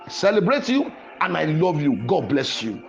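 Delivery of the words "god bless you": usual speaking voice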